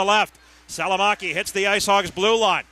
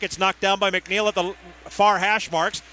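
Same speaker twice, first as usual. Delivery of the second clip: ordinary delivery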